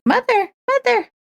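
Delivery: put-on high nagging child voice; whiny kid calling mother. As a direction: pester mom over and over. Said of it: "you won't be like".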